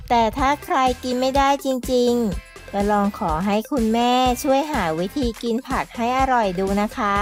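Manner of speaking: ordinary delivery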